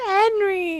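high, loud drawn-out name; mock adoring squeal. Fan retells crying a favourite's name, high squeal. Retold fangirl cry.

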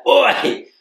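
sharp, high, loud "Oi!" from behind; loud call to stop someone; sudden shout. Retold or imitated. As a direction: bark it out like a copper calling.

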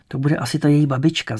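usual speaking voice

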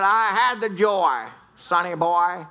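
lightly voiced lady, drawn out; lady in story. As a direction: stretch the words, imitating the lady's reply. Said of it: "He came up to a lady".

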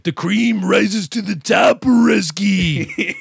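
hammed-up wrestling promo, high and drawn out; Macho Man Randy Savage. Villain taunted in a showdown promo, hammed-up wrestler voice. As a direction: stretch every word into a showboating wrestler's taunt. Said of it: "I'm the Macho Man, uh, Randy Savage".

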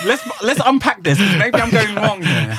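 high, playful mock-serious voice; teasing high-pitched voice. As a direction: put on a squeaky therapist tone. Mock-dramatic unpacking.

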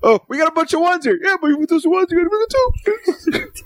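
squeaky high cartoon voice; high silly voice. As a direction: pitch way up, goofy and whiny. Mocking computers that dislike two.

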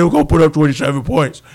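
loud, low booming put-on voice; hammed-up big athlete impression; Calais Campbell. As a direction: boom it out, low and heavy. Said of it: "that's my Calais Campbell voice".

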